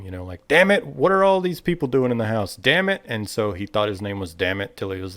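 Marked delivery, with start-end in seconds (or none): 0.5-3.0 raised, higher exasperated shout; acted angry outburst, louder and higher; yelling dad. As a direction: holler like a fed-up father at his kid. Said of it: "his dad was always yelling at him".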